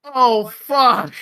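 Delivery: loud, pained cursing; pained cry. Retold or imitated. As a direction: yell as the bone clicks back in. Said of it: "And I was like".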